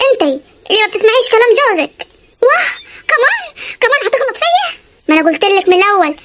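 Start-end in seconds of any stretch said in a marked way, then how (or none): 2.4-4.8 much higher, indignant cry; how dare you insult me; wounded fury at her husband; outraged. Acted.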